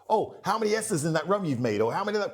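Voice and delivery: high, pushy question; mock eager geek. Trade show fan grilling the master blender, high pushy.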